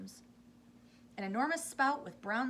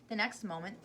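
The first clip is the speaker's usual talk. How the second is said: ordinary delivery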